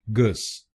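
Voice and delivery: "गस" as gus with a short u; last syllable demo. Shown as a demonstration. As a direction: end crisply on "गस", gus. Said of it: "बोलिए गस".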